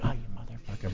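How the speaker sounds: whispered mock resentment; muttered curse. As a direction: curse him under your breath. Jealous aside about mobbed star.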